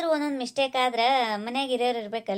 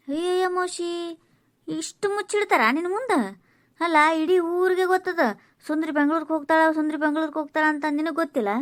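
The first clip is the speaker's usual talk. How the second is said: high, astonished village gossip; incredulous gossip; 4 semitones higher than usual. Acted. Neighbour astonished that aunt missed the village news, incredulous.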